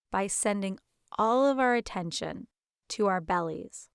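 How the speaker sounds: ordinary talk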